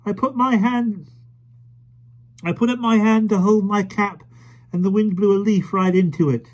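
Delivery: put-on high, loud eager boy; excited child. A narrator's reading. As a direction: blurt it out, breathless with excitement. Michael excited about leaf.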